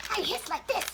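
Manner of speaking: very high puppet cat voice. Pretend. Puppet Mittens explaining what she does when angry, very high voice. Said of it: "And she could go".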